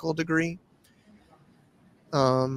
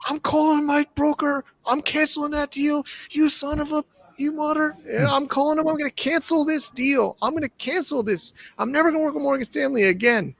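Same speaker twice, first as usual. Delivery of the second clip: high-pitched mock tirade, without real volume; angry client cancelling his deal, imitated in a high voice; imitated screaming client; 10 semitones higher than usual. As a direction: ham up a furious client's rant, pitched high. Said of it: "he was going off on me, like, screaming".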